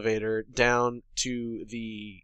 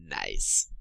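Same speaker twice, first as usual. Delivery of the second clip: a very low, flat "Nice." with a falling end; deadpan Alice "Nice"; 6 dB quieter than usual. Retold or imitated. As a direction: drop the word "Nice." far down and keep it short. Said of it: "No, no, you got it wrong".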